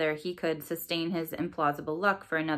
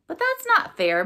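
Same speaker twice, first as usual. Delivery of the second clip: loud, much higher indignant cry; a child's outraged protest; 13 semitones higher, 7 dB louder than usual. Acted. Morgan objecting at the dinner table, loud high protest. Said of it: "Morgan protested".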